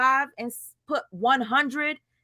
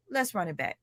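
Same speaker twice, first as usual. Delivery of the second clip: normal talking voice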